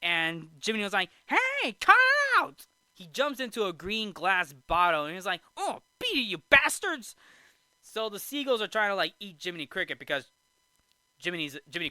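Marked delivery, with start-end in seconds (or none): 1.3-2.7 high, loud mock yell; hammed-up protesting cry; Jiminy Cricket. Jiminy attacked by seagulls, acted. 5.6-7.1 high mock-angry yell, getting louder; hammed-up outrage; Jiminy Cricket. Jiminy shoos seagulls, acted.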